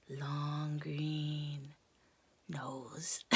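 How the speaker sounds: soft hushed narration; suspenseful whisper. A narrator's reading. Storyteller whispering as the crocodile wakes, hushed.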